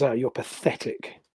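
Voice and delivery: quiet, dismissive put-down. Acted. Prisoner looks at her fidgeting cellmate, dismissive. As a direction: brush him off under your breath. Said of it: "looks at him dismissively and sort of says".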